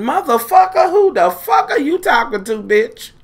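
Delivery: outraged comeback, voice leaping up; indignant outburst. Retold or imitated. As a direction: pitch jumps way up on the insult. Retold outburst at the lifeguard.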